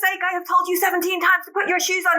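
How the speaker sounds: high exasperated scolding, lightly done; a mother fed up about the shoes; hammed-up mom nagging; mock exasperated mom. Mother quotes herself yelling.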